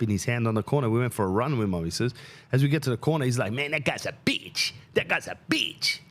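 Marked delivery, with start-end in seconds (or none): 3.5-6.0 imitating a crack addict. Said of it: "He's yelling at his mate".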